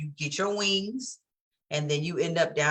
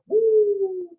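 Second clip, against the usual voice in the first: a high, loud whoop; a high yelp of overwhelm; high woo; 6 dB louder than usual. Retold or imitated. Reacting to being triggered.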